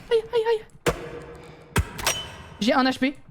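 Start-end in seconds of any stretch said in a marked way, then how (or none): 0.1-0.9 high panicked wail. Real, not acted. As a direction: cry out as it hits you. Monster catches him.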